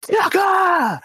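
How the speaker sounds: New York bleacher yell with a hard, punched K in "Tucker"; New York heckler accent. Retold or imitated. Friends imitating the New York bleacher fans' heckle, a loud punched K. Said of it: "with this kind of accent where they put emphasis on the K".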